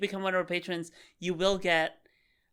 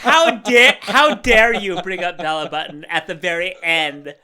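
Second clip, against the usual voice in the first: mock outrage; 10 dB louder than usual.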